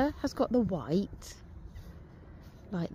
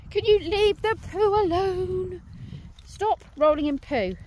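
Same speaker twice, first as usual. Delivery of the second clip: high, slow, loud telling-off; loud scolding; 9 semitones higher, 6 dB louder than usual. Real, not acted. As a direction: call the dog off the poo, loud and exasperated. Dog rolling in poo.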